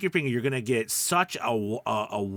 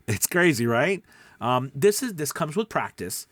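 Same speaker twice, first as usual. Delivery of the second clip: ordinary talk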